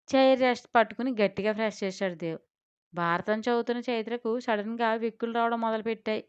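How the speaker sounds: ordinary delivery, nothing marked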